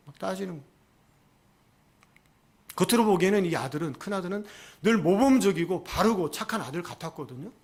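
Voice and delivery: normal talking voice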